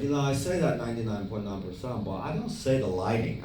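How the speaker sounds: lightly voiced, quiet retold objection; a light impression of an odd filmmaker; Tommy. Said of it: "first thing he says to me".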